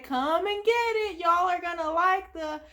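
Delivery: high cheery southern call; Sandy voice. Retold or imitated. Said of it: "she's like".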